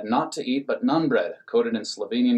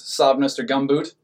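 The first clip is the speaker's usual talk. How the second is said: ordinary delivery, nothing marked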